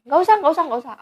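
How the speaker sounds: retold heated refusal, high and loud; emotional refusal. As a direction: cut off the offer with rising heat. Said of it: "Aku udah emosi banget".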